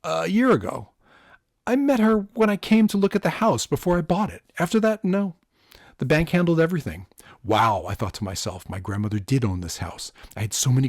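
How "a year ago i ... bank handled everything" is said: higher, louder wary homeowner voice. A narrator's reading. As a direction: play the nervous homeowner answering.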